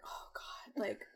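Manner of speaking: breathy whispered aside. Retold or imitated. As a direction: whisper it to yourself. Imagined envious scrolling reaction.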